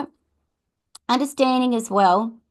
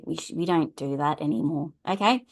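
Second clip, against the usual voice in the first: ordinary talk, nothing marked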